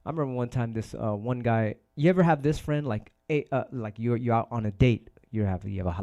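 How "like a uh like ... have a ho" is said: usual speaking voice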